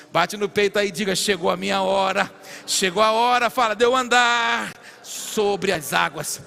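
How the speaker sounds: loud rallying declaration; preacher's forceful call, stretched out at the end; fervent preaching. Real, not acted. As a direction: proclaim it for the crowd to repeat. Leads congregation in a declaration.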